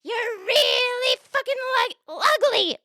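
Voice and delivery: slow, high squawking parrot insult. Acted. Parrot insulting a passing lady, high drawn-out squawk. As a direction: stretch it out in a piercing parrot voice. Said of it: "Parrot says".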